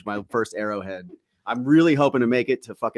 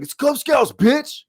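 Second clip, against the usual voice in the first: mock yell; 5 dB louder, slower than usual. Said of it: "it sounded like he wanted to be like".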